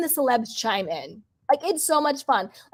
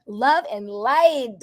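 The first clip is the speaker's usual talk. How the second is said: slow mantra; much slower than usual. Real, not acted. Chat motto, drawn out.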